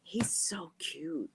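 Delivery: whispered slowly, dreamy. Real, not acted. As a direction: sigh it out softly, slowly. Repeating gush softly.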